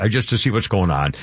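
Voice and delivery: ordinary talk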